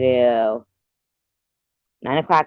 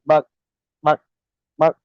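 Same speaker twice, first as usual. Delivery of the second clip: chicken clucking; 3 semitones higher, 3 dB louder than usual. Retold or imitated. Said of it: "he says".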